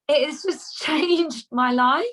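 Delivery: laughing through the words; giggling while telling how it changed her life. Real, not acted. Laughing about her toilet ritual.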